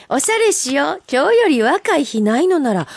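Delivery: haiku read out high and lively; bright recital. Acted. Host reading a listener's haiku on air, lively. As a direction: deliver the haiku with bright lift.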